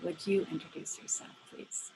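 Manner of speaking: usual speaking voice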